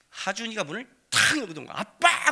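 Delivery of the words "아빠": put-on child storming in, shouting for his father; angry shout. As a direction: kid yelling Dad, high and loud. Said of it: "그러는 거야, 성질나서".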